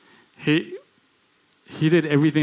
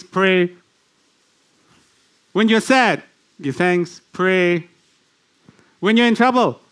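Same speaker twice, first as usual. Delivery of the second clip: preaching litany; 3 semitones higher than usual. Acted. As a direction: build it like a chant from the pulpit.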